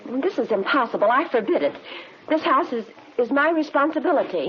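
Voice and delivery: indignant protest. Acted. Housekeeper forbidding the detectives to dig up the cellar, indignant.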